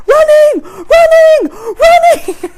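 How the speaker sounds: high, loud imitation of a shouting toddler. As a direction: belt it out like an excited running toddler. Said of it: "just runs shouting".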